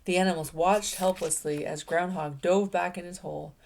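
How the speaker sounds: usual speaking voice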